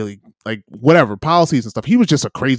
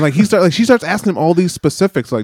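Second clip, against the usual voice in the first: usual speaking voice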